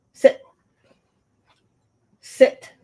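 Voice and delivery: loud, short order to a dog; dog command. Real, not acted. Owner tells her excited dog to sit, loud command.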